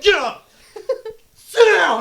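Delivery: high, loud barked pair; coach's orders. Retold or imitated. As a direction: bark up then down. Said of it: "he's like".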